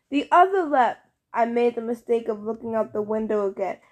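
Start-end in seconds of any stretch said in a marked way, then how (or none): 0.1-1.0 high loud yelp. A narrator's reading. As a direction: yelp it in panic. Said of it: "who yelped".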